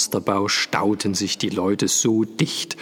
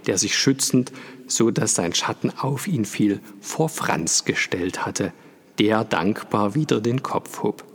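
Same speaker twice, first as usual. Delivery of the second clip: usual speaking voice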